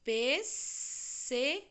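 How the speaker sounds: "Pêssego" esticado bem devagar; drawn-out reveal. Shown as a demonstration. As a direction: revele a palavra lentamente. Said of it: "Que palavrinha é?". (pêssego dito bem devagar)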